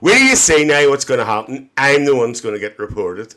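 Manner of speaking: ordinary delivery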